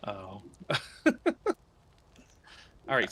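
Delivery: ordinary talk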